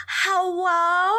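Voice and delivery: high, sing-out mock hello; mock lover's greeting. Imitating him going in head first, high mock hello. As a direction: call it out high and drawn out. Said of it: "and he's just like".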